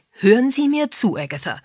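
strict telephone scolding, dropping off on the name; stern. A narrator's reading. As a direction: demand attention sternly. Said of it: "erklang Jack Pomfrets strenge Stimme".

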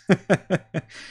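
high, chuckling laugh. Real, not acted. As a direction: laugh at your own troubles. Laughs at own pronunciation troubles.

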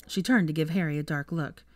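usual speaking voice

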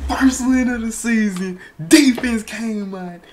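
high, loud victory yelling; ecstatic celebration. Real, not acted. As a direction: scream with joy at the win. Game-winning interception celebration.

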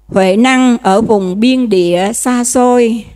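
slow ceremonial reading of a holy text; solemn scripture reading. Acted. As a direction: read the sutra line with weight. Reads scripture passage aloud.

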